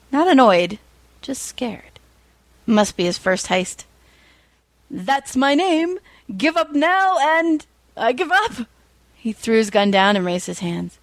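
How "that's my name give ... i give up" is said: higher, slow boast turning to surrender; hammed-up heroic cry. A narrator's reading. Hammer's line and the robber giving up, high and slow. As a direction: bellow it slowly, puffed up.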